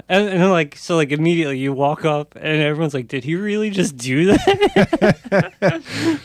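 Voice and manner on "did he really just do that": slow, higher disbelieving quote; incredulous. Retold or imitated. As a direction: drawl it out in disbelief. Everyone reacting incredulously.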